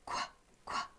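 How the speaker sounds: "qu-" whispered as a breathy kw sound; whispered letter sound. Shown as a demonstration. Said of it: "This sound is qu".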